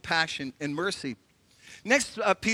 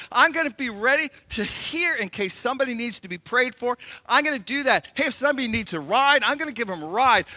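high, bright with eagerness, higher voice; a hammed-up eager helper, higher and louder; eager churchgoer; 4 semitones higher, 3 dB louder than usual. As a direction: gush like someone thrilled to go help. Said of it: "It's that arm waving".

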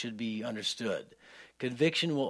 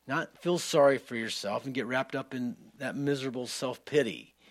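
ordinary delivery

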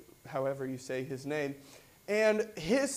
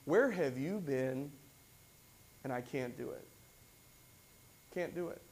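voice of inadequacy; 3 semitones lower, 6 dB quieter than usual. Acted. Preacher voicing the inner voice of inadequacy, softly defeated. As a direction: shrink into doubt, barely audible. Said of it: "a voice of inadequacy saying".

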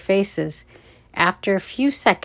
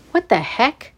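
higher, indignant outburst; angry; 5 semitones higher than usual. A narrator's reading. Guard catches the kids in the hall, angry outburst. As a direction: cut in with an angry challenge. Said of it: "an angry voice cut the air".

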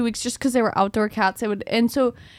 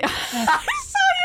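laughing; much slower than usual. Real, not acted.

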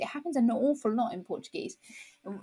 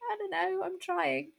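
high-pitched helpless plea; mock-helpless, high little protest; flustered protest; 10 semitones higher than usual. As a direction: voice a struggling learner's exasperated thought, pitched up. Acting out flustered learner's thought.

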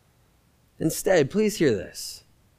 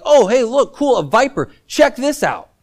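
higher, louder, casually thrilled at a viper; careless mock enthusiasm; 7 semitones higher, 5 dB louder than usual. Paul mocked as casually delighted by the viper. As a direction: play it like someone cheerfully showing off a deadly snake. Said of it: "and be like".

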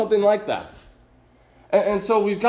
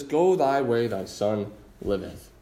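low, soft, slow blessing; gracious and gentle; 8 semitones lower, 4 dB quieter, slower than usual. Acted. Jesus answering the official with grace, low and tender. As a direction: hand him grace quietly. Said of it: "But Jesus never runs out of grace".

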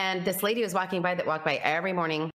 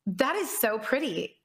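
much higher, slow and marvelling; awe-struck; 9 semitones higher, slower than usual. Retold or imitated. Retelling her awakening to the host, awe-struck and high. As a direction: gasp it out as if seeing metal for the first time.

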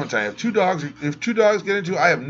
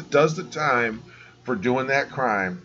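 ordinary talk, nothing marked

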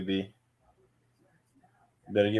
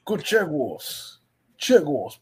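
mock British accent, drawn out and slightly higher; bad British accent; 4 semitones higher, much slower than usual. Sports podcaster teasing a friend about the London Jaguars, bad British accent. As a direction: put on a terrible British accent. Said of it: "the worst British accent I've ever heard".

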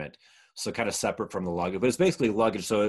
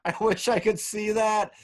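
high, shouted words through laughter; laughing while talking; 13 semitones higher, 4 dB louder than usual. Retold or imitated. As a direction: howl it out, cracking up. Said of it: "He was laughing so hard".

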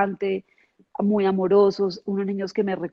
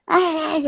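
hysterical cry; 7 semitones higher than usual. Retold or imitated. Mimics hysterical old woman.

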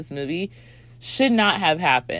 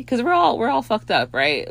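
normal talking voice

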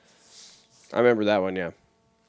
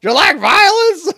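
very high, loud, slow clown; creepy clown voice; 12 dB louder, slower than usual. Pretend. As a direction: menace kids in a squeaky clown voice.

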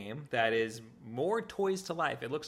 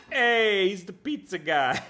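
quoting Simon, pizza guy voice; 8 semitones higher, 11 dB louder, slower than usual. Retold or imitated. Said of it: "Simon says".

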